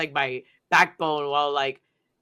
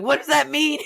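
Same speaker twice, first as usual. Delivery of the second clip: panicked question; 8 semitones higher, 5 dB louder than usual. Retold or imitated. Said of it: "I'm like".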